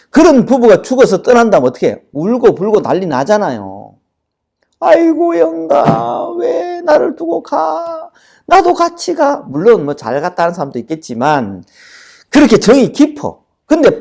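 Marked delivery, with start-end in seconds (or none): 4.8-9.4 높은 목소리로 울먹이며 곡하듯; 떠나는 배우자를 붙잡고 우는 과장된 곡소리; 통곡하는 흉내. Acted. (울먹이는 곡소리 흉내)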